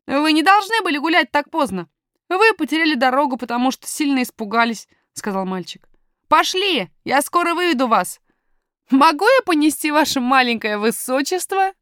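put-on confident young miner talking to strangers; boy miner, self-assured.

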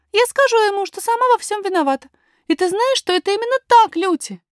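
put-on very high child's voice; high-pitched, determined little princess; little princess, earnest. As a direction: insist earnestly as a small girl.

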